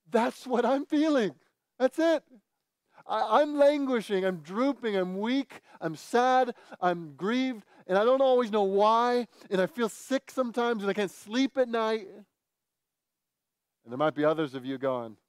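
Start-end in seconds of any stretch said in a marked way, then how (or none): 0.1-12.1 high-pitched, acted outpouring of relating; voicing an anguished reader, insistent and high; acted heartfelt recognition. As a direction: play someone who finally feels described, pitched up. Voicing readers identifying with the psalm.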